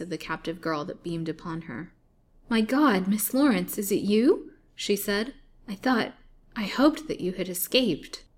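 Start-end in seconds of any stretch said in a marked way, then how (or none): 2.5-8.2 surprised terror. A narrator's reading. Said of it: "cried out in surprise and terror".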